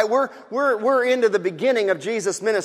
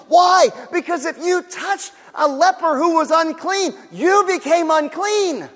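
high, loud, impassioned; preaching at full force; 7 semitones higher, 3 dB louder than usual. Real, not acted. Preacher stressing the danger of touching a leper, impassioned. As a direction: build to a ringing cry.

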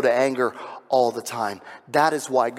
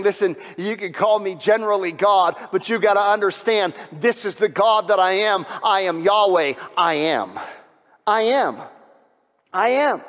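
higher, louder, rising preaching fervor; fervent preaching; 6 semitones higher, 5 dB louder than usual. Real, not acted. Preacher proclaiming God's name to the congregation, fervently. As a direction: build to full force on I am.

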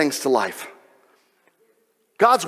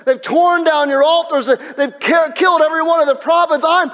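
high, distraught prophet pleading with God; high-pitched, hammed-up despairing rant; Elijah; 10 semitones higher, 3 dB louder than usual. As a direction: cry out in loud, desperate complaint. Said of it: "And Elijah says this. He says".

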